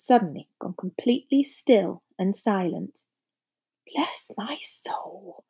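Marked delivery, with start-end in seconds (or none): 3.9-5.4 slow, hushed whisper; old barman's soft whisper; whispered awe. A narrator's reading. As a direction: whisper it slowly, as if stunned. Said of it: "whispered the old barman".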